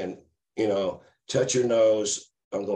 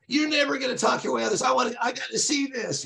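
Ray's crazy laugh. Retold or imitated. Drunk friend mocks driver being pulled over, high cackling. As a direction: crow it out, half laughing at his buddy's trouble. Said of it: "he just like".

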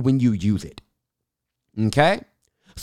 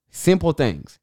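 ordinary delivery

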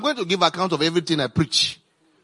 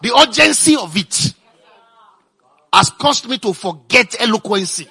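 loud, higher, urgent preaching push; 5 semitones higher, 7 dB louder than usual. Real, not acted. Preacher stressing the urgency to the congregation, loud and high. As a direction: press the urgency on the church, raising the voice.

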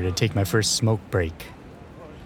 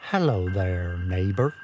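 Mister Rogers. Shown as a demonstration. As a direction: greet like a kindly TV neighbor. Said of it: "I'm trying to talk like Mister Rogers".

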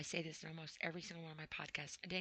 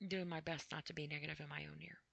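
usual speaking voice

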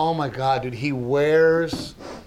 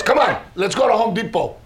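hurried, higher-pitched father-in-law impression; Daddy Raymond; 6 semitones higher, 3 dB louder, faster than usual. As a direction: rattle it off as a mock bossy father-in-law. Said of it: "My dad does not talk like that".

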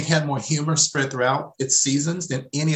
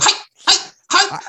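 squeaky Chekhov imitation; 6 dB louder than usual. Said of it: "they were going".

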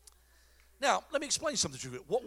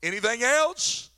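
nod along to the voice from the bush, eagerly; eager yes-man; 5 dB louder, much slower than usual. Pretend. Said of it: "I'd have been like".

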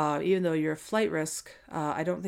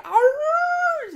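monster roar; 9 dB louder than usual. Shown as a demonstration. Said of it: "Not like".

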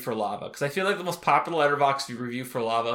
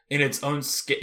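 ordinary talk, nothing marked

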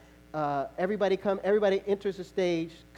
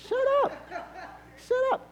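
laughing protest. Retold or imitated. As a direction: yell it at him half laughing. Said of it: "And I was like".

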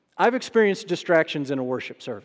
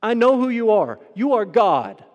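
loud, higher-pitched declaration; demon's loud proclamation; 5 semitones higher, 7 dB louder than usual. Retold or imitated. Demon telling everyone who Jesus is, loud declaration. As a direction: proclaim each sentence forcefully. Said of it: "he moves him to say".

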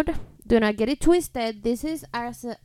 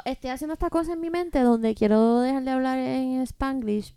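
ordinary talk

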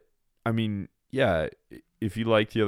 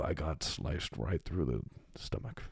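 softer lightly voiced Jedi master; a hint of a wise old mentor; Qui-Gon Jinn voice; 4 semitones lower, 8 dB quieter, slower than usual. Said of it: "Qui-Gon Jinn, man".

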